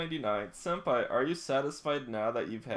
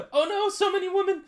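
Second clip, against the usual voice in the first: high loud yelp of surprise; shocked; 9 dB louder than usual. Real, not acted. Streamer reacting to a crowd of women, shocked yelp. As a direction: gasp it out in shock.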